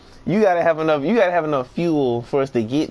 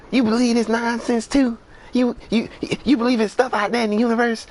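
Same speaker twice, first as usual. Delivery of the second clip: high, quick coworker voice, lightly done; imitating her voice; 10 semitones higher than usual. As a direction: mimic how she really sounds. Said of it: "that's how she sound".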